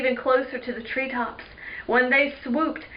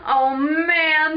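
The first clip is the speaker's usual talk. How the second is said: loud, higher cry of dismay; cried out; 5 semitones higher, 6 dB louder than usual. Acted. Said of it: "Neil cried".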